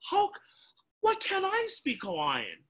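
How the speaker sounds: put-on high, upset kid asking why; upset child. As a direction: protest like a left-out child. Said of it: "I said".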